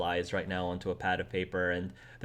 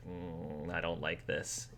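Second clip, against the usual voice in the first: low, quiet sulky grumble; pouty; 3 semitones lower, 10 dB quieter, slower than usual. Acted. Game master voicing a sulking boy, pouty. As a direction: pout it out softly, deep in the throat. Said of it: "gives you a really pouty look".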